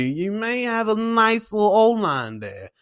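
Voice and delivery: ordinary delivery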